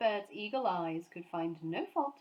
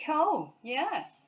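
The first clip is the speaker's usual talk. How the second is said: louder, higher mock surprise; sarcastic gatekeeper; 8 semitones higher, 8 dB louder than usual. A narrator's reading. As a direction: scoff at the bear. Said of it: "said the gatekeeper sarcastically".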